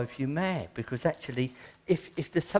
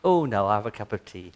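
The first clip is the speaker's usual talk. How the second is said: mock fussy believer; 3 semitones lower, 7 dB louder than usual. Mock answer in trivial prayer.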